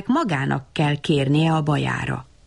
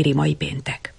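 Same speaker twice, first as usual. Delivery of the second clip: plain reading voice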